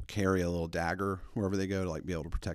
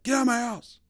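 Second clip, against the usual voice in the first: high, loud imitation of a furious dad; bear-like roar; 9 dB louder than usual. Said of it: "screaming at this guy like a bear".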